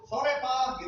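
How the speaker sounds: high, bright mock welcome; imitating an eager wife; cheerful greeting. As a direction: greet him sweetly at the door.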